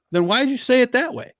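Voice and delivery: a mild mock retort pitched high; half-acted disbelief, thrown up high; incredulous retort. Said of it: "And I'm like".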